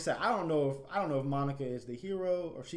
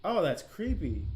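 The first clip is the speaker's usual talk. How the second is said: creeped out; 7 semitones higher, 8 dB louder, slower than usual. Acted. Reacting to Yuri's creepy scene.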